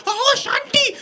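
very high, loud mock wail; melodramatic mother. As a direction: cry out for a daughter, hammed up. Mother wails for burning daughter.

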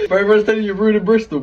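laughing through the words; cracking up while teasing. Real, not acted. Friend laughing at his pain.

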